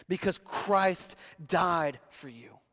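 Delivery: ordinary talk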